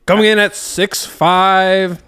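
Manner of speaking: slow booming announcer; fighting game announcer. Acted. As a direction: hype the fighter's height.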